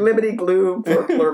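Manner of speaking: burbled gibberish imitation of a thick accent; mock garbled speech. Teller answering co-host's question, mock garbling. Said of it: "is that how Irish people sound to you?".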